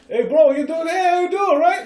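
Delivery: loud, high, bad Boston accent. Shown as a demonstration. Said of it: "with a Boston accent".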